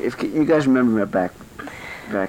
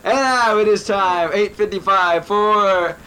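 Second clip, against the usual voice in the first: slow, high announcer build-up; radio announcer voice; 9 semitones higher, much slower than usual. Pretend. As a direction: announce it like a game show host. Announcing the segment.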